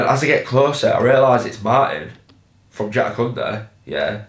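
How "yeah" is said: ordinary talk, nothing marked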